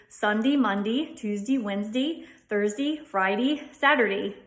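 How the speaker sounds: "Sunday" to "Saturday" each ending in a long ee; days with long E. Shown as a demonstration. Teacher reads the days of the week in Delco style. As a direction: stretch the -day in "Sunday" to "Saturday" into a long dee. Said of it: "end in a long E sound".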